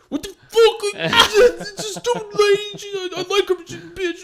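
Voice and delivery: very high, slow, whining voice; whiny crying rant. Retold or imitated. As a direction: wail it like a sobbing, whiny man. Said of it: "And he's like".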